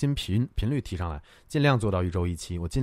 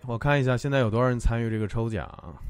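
ordinary talk, nothing marked

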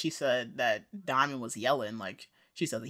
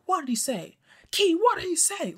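put-on demanding boyfriend; 13 semitones higher than usual. Voicing Derek's demanding question.

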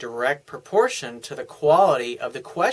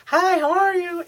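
much higher, sugary mock greeting; mock sweet girlfriend voice; 3 dB louder than usual. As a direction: lightly impersonate a doting girlfriend on the phone. Mock sweet woman calling.

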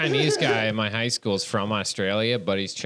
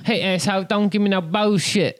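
higher-pitched mock Chinese accent; 6 semitones higher than usual. Shown as a demonstration. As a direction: put on a comic Chinese accent. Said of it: "That was my Chinese accent".

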